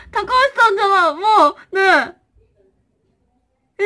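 high, loud mock-crying puppet voice; crying yokai plush. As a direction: play the hurt little yokai. (高い声で泣きそうな妖怪のぬいぐるみ)

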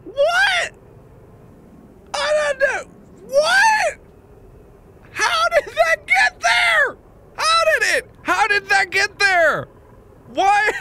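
very high, squealed disbelief; exasperated high-pitched incredulity; flabbergasted outcry. Real, not acted. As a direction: cry out in total bafflement. Outburst of disbelief at game puzzle.

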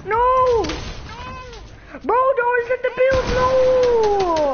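yelling in protest. Real, not acted. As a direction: shout in protest. Yelling at reset.

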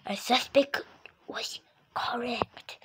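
hushed whisper. Acted. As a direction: whisper it secretly. Secret detective reveal.

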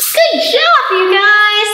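put-on high, loud cheer for the kids; excited praise. Librarian cheering children after the song. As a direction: cheer them on.

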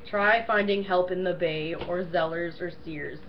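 ordinary talk, nothing marked

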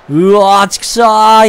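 long drawn-out groan of defeat; frustrated cry. Real, not acted. Player cursing his missed cover, frustrated cry. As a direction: cry out at a blown play.

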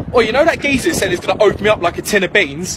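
usual speaking voice

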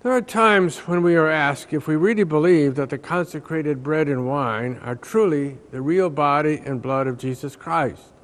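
normal talking voice

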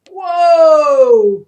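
big loud falling whoa; warned whoa. Acted. Buffaloes warning as they stumble, loud bellow. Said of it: "warned the buffaloes".

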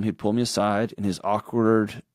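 usual speaking voice

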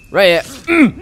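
excited callout landing the kill. Real, not acted. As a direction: call it out as you strike. Aiming at the last enemy.

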